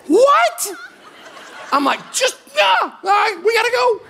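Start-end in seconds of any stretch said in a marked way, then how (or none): 0.1-0.7 shocked what. Retold or imitated. Wife stunned by the plan. 2.1-4.0 high, hurried, half-shouted hustle; urgent rush. Retold or imitated. Rushing wife before man enters.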